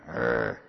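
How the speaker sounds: very low mock growl; preacher showing the dog's wrinkled-up snarl, low growl. Imitating his dog.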